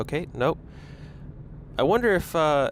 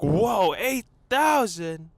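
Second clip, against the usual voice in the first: amazed; 7 semitones higher, 3 dB louder, much slower than usual. Retold or imitated. Friend amazed at subscriber count.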